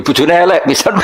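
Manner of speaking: normal talking voice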